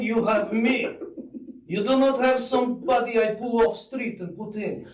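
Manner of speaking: playful Russian accent, pitching himself. Shown as a demonstration. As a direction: ham up a Russian realtor accent. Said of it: "I can do in the Russian voice".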